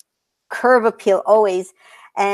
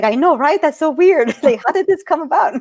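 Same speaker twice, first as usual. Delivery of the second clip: high, laughing mock amazement; mock surprised self; 7 semitones higher than usual. Answering her sisters with high, laughing mock surprise. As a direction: play along with the sisters' disbelief. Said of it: "I'm like".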